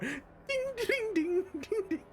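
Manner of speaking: very high plinked dings by mouth; the mini harp, imitated vocally. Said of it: "as he pulls it out".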